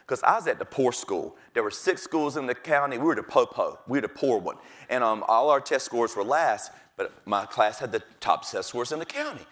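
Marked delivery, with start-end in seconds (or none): none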